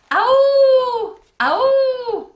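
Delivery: very loud, high, long howl; hideous howl. A narrator's reading. The Howler answers the campers, hideous howl. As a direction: howl like a monster in the woods. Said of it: "I thought I heard it breathing".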